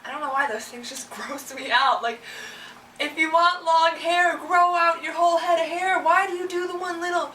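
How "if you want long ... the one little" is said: mock rant. Mock rant at rat tail wearers.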